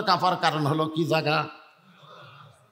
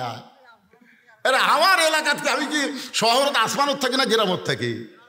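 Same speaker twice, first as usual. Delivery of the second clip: ordinary talk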